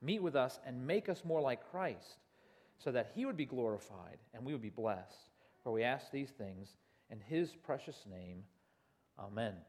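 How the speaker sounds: low, soft, measured prayer; hushed closing prayer. Real, not acted. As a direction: pray quietly and reverently, dropping off at phrase ends. Closing prayer before the sermon.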